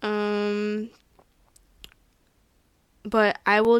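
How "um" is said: ordinary talk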